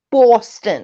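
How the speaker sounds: Boston accent, punched "Boston". Shown as a demonstration. Trying out where Babs is from, mock Boston accent. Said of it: "where her accent is from".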